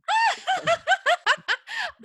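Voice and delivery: loud, very high peal of laughter; real, high shrieking laughter; big laugh. Real, not acted. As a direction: crack up helplessly at a joke. Host cracks up at blog joke.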